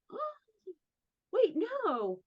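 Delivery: high squeak of disbelief, then slowing to deny it; startled protest. Retold or imitated. Retelling her shocked denial to the therapist, high and startled. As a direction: caught off guard, pushing the idea away.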